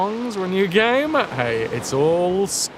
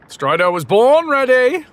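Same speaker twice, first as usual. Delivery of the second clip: higher, louder confident boast; hyped boast; 10 semitones higher, 8 dB louder than usual. Pretend. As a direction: announce it like a hero. Character hype line.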